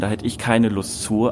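usual speaking voice

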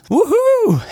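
high squealing woo; cheering. Acted. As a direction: squeal with joy. Cheering about the concert.

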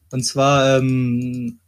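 usual speaking voice

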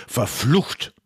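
sharp angry outburst; angry curse. Acted. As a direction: spit out the curse in frustration. Magnus curses the empty desert.

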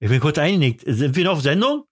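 ordinary delivery

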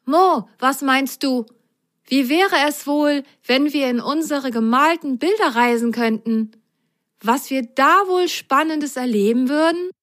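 dreamy, curious sock asking the cat; Grasgrün character voice. Acted. Grasgrün voice held.

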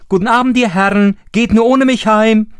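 high, fast and loud cheeky call; loud high teasing goodbye. A narrator's reading. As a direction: shout back mockingly from the hole. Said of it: "rief er ihnen zu".

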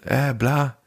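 lightly mocking British teacher; mock British English; British accent. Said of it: "mit seinem, äh, britischen Akzent".

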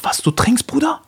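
whispered, pitch jumping up on Bruder; surprised whisper. Retold or imitated. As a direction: gasp it out in disbelief. Friend surprised in story. (überraschtes Flüstern)

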